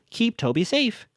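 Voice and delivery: high, mock talking-doll voice. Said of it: "And then Spencer says".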